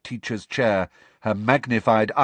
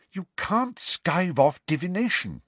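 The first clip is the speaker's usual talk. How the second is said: a stern, higher Hermione; firm and disapproving, a touch raised; severe; 8 semitones higher than usual. A narrator's reading. Hermione scolding Harry for wanting to skip class, severe. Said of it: "said Hermione severely".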